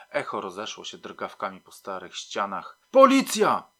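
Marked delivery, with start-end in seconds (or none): 2.9-3.7 loud, high-pitched yell; shouted police announcement. A narrator's reading. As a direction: bellow it as a policeman at the door. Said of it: "krzyknął Smyczek".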